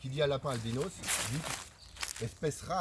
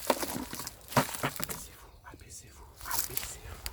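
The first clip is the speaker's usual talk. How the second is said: whispered order to get down; hushed, voiceless warning, repeated; urgent whisper; much slower than usual. Acted. Hunter to followers near the prey, whispering.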